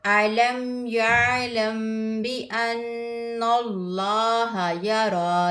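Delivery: slow, drawn-out melodic recitation; Quran recitation. Acted. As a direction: chant each verse with tajweed. (धीमी क़ुरआन तिलावत)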